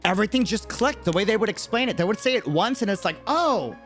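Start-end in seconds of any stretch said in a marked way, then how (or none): none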